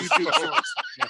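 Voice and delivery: high, laughing squeal. Real, not acted. Laughing at own joke.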